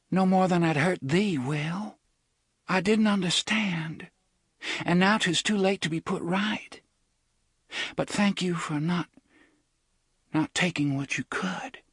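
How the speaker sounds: put-on a girl saying a tender goodbye; tender young woman. A narrator's reading.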